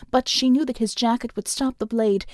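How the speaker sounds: ordinary delivery, nothing marked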